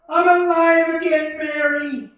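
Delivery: loud, high outburst of relief; elated cry. Acted. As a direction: shout it with pure joy. George reunited with his wife.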